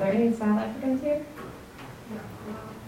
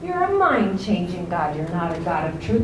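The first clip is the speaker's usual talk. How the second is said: ordinary talk, nothing marked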